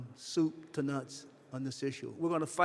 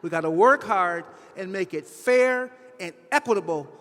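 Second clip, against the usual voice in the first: louder, rising passion, pressing every line; getting emotional; 3 semitones higher, 7 dB louder than usual. Real, not acted. Speaker urging the audience to fix the healthcare system, emotional and loud. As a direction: pour real feeling into the call to fix the system. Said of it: "I do apologize for getting emotional".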